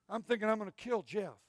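usual speaking voice

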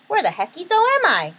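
high, loud kitten voice; wondering Skipito. A narrator's reading. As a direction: call out your bewilderment in a high-pitched voice. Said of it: "wondered Skipito aloud".

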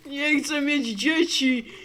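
usual speaking voice

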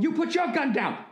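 high-pitched mock standoff yell, "your" stressed; mock movie yell. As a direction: bark it like a Bourne Identity standoff. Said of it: "yelling at the other guy going".

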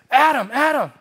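high, loud call of his name; retold excited call. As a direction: imitate a friend calling out from afar. Friend calling him across the bus.

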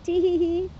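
high sly tee-hee-hee giggle; high mischievous witch titter. Acted.

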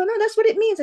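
imitated classmates insisting; mock insistence. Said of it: "they go".

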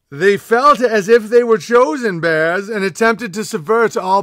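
mock-epic lore reading to the king; grand proclamation read. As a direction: proclaim it like a royal herald. Continues mock-epic letter reading.

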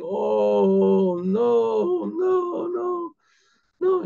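long wailing moans climbing high; acted, drawn-out tearful moan; moaning and crying. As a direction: imitate a man sobbing, pitch rising to a wail. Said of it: "he's just moaning and crying".

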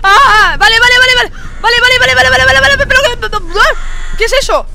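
panicked yelling. Real, not acted. Panic scream in game.

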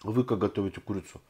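speaking around food; slightly muffled chewing speech; mouth full, chewing. Real, not acted. Seen 0: fork into mouth at start, then chews while talking on.

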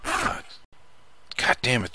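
real jump-scare cursing, pitch leaping at first; startled. Real, not acted. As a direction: swear as you recover from a sudden fright. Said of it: "that scares the shit out of me".

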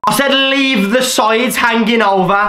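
loud, exasperated complaint; angry outburst. Real, not acted. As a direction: burst out at the barber. Ranting at the barber's mistake.

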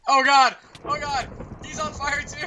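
high frantic shouts; panicked yelling. Real, not acted. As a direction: yell it in alarm. Panic, someone burning.

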